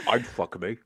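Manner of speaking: low, soft mock Scottish murmur; Sean Connery. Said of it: "He's in Silence of the Lambs".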